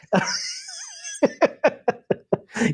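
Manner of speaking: giddy high-pitched laugh; high squealing laugh. Real, not acted. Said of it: "laughing after the impression".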